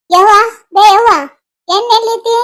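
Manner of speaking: impatient daughter calling out. Acted. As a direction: call sharply across the house for mother. Daughter calls mother about missing oil.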